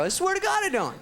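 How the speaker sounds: high, desperate swearing; pleading denial. Acted. Cornered boy lying to schoolmates, high pleading denial.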